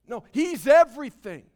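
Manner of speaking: emphatic declaration. Real, not acted. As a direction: declare it with force. Preacher emphatic correction.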